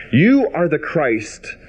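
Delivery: slow, weighty, YOU leaned on; solemn scripture reading. Acted. Preacher voicing Peter's confession, slow and solemn. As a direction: let the confession land slowly.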